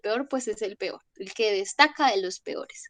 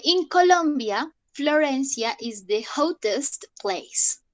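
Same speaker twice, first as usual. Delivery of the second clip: slow dictation; 2 semitones higher, slower than usual. Shown as a demonstration. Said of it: "vamos a hacer nuestras oraciones".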